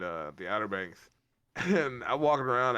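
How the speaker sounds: normal talking voice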